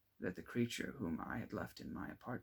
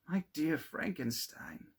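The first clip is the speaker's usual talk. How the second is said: high, slow delighted greeting; glad exclamation; 6 semitones higher than usual. Acted. Clerval greeting his friend at the coach, delighted. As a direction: call out his name in happy surprise. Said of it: "exclaimed he".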